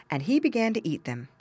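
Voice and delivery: ordinary delivery, nothing marked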